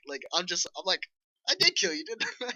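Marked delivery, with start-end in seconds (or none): 1.5-2.5 much higher, puzzled realization; incredulous, voice jumping up; mock surprise. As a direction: player startled by an orc back from the dead. Player surprised by returning orc.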